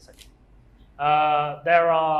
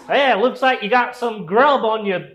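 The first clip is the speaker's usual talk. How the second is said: high, loud cowboy greeting; cowboy boots voice; 7 semitones higher, 8 dB louder than usual. Acted. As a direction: holler it like a hammed-up cowboy. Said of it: "That's a weird accent".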